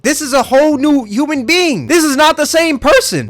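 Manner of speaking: high, loud disbelief; shocked. Real, not acted. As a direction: blurt it out in shock. Shocked at the second photo.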